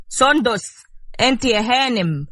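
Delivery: calls her name out loud, sharp and rising. A narrator's reading. Husband calling his sleeping wife loudly. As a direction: shout her awake from the doorway. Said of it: "ونادى بصوت عالي".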